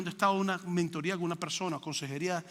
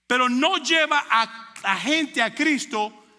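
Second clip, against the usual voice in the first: louder, higher preaching push; fiery preaching; 4 semitones higher, 6 dB louder, slower than usual. Acted. Preacher pressing the church on the gifts, louder and higher. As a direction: drive the point home to the congregation.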